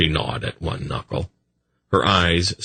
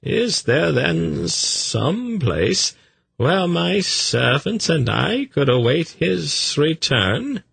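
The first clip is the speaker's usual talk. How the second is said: high, slow, swooping voice; polite messenger; 12 semitones higher, slower than usual. A narrator's reading. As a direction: ask a favour with slow deference.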